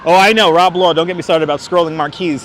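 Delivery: normal talking voice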